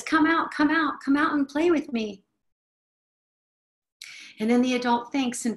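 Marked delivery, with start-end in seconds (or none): 0.0-2.2 put-on high coaxing child's call; higher sing-song child's invitation. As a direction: call out like a child wanting to play. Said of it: "And the child says".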